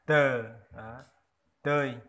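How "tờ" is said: tờ said loud and short; loud demo word. Shown as a demonstration. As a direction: model tờ as the locals say it. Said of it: "người dân tộc đọc là". (đọc to chữ tờ)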